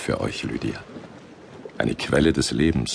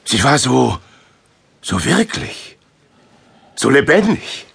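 high, wondering rush; awed excitement; 9 semitones higher than usual. Acted. Paulus telling Silas of his vision, awed. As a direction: still amazed by the dream.

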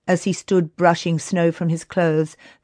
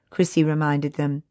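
ordinary delivery

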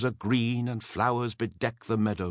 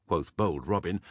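ordinary delivery

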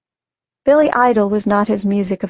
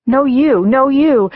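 louder and higher, bickering; childish shouting; 5 semitones higher, 5 dB louder, slower than usual. Acted. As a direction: escalate the sibling squabble. Sibling squabble escalating.

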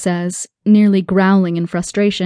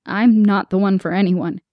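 ordinary delivery, nothing marked

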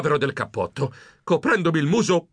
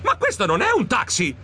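raised-pitch objection; indignant, higher-pitched complaint; protesting; 6 semitones higher than usual. Acted. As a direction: protest in alarm at being whisked off.

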